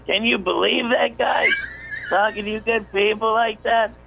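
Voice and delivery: high, strained mock scream; Sam screaming. Sam on the radio about the kid who bombed, imitated screaming. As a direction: imitate an outraged comic screaming, far above your own pitch. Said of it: "okay, he's screaming".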